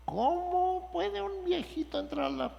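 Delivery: very high, quavering little old man voice, a touch mocking; high-pitched silly old man asking in disbelief; Nicodemo as an old man. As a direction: squeak it out like a baffled old man. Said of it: "Y Nicodemo dijo".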